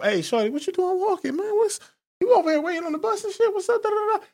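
high, hammed-up pickup voice; mock street pickup line. Man calling to a woman at the bus stop, high hammed-up pickup voice. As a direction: play the smooth guy hollering from the car.

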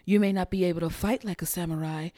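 soft, low menacing villain line; O-Ren Ishii quote. Retold or imitated. Host quoting O-Ren Ishii's Kill Bill line softly. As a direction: purr the threat coolly and softly. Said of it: "the part where she says".

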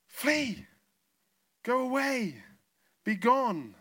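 high, loud cry driving darkness out; forceful command. Acted. Preacher telling the darkness to leave, forceful command. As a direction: banish it with short, ringing calls, falling away.

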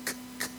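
"k" as a crisp voiceless k, no vowel; "k, k" clicked out as a bare consonant; hard K sound. Shown as a demonstration. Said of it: "So like a C and a K".

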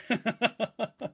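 amused burst of laughter; short laugh. Real, not acted. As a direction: laugh at the suggestion. Laughs at listener comment.

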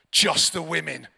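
loud, low mock-angry order; angry command. Gathering leader orders the congregation to sing by section, angrily. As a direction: imitate a cross leader ordering the room. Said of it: "you know, quite angrily".

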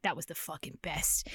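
low, softened recollection; understated awe. Retold or imitated. Host recalls reading it at thirteen, low hushed awe. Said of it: "reading that when I was thirteen".

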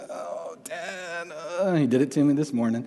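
imitating a whiny child; whiny kid. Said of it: "I don't know if you've ever seen kids do that".

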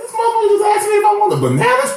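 high, fast squeal of fake outrage; mock outrage. Real, not acted. Joking to his co-host about the bananas question, high fast squeal.

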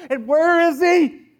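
loud, slow searching call; preacher's slow, loud plea; fervent cry. Acted. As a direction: cry out for God's presence.

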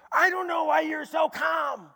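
mock shouting guy in the intervention; loud outburst. Retelling man's outburst.

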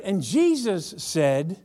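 ordinary talk, nothing marked

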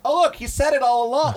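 high, breezy, sing-song voice; airy chipper quote. Retold or imitated. She cheerfully shrugs off his evil plan, high and airy. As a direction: act as if nothing is odd, lightly. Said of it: "She's like".

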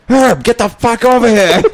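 high, loud, slow mock yell; yelling at janitor. Suggesting how to summon the janitor, mock yell. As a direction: bellow it across the hall. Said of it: "just say".

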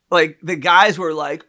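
normal talking voice

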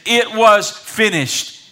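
usual speaking voice

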